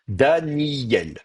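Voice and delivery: "Daniel" stretched as Dani-yyel; held yod. Shown as a demonstration. Teacher demonstrates the consonant yod, lengthened "Daniel". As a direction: linger on the y glide in "Daniel". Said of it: "j'appuie sur le yod".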